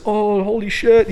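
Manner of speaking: high, slow, awed gasp of an audience; a hammed-up high crowd reaction, slowed down; imitating a moved crowd. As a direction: echo the crowd's stunned murmur, drawn out. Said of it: "you know, like, when you cry".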